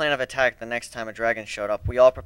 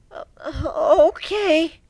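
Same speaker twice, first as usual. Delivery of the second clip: very high, shaky stuttering griffin; terrified stammer; 5 dB louder, much slower than usual. Acted. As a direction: stammer in fear. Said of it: "Poor thing was shivering".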